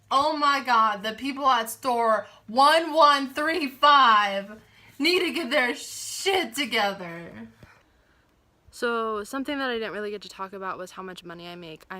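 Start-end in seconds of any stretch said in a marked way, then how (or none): 0.1-7.3 imagined corporate guy. Pretend. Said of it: "corporate guy sees this and is like".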